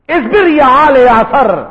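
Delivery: اصبر proclaimed high and drawn out; proclaimed loudly. Shown as a demonstration. Preacher booming the Prophet's words to the crowd, loud and slow. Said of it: "یہ جملہ ایسا جملہ ہے".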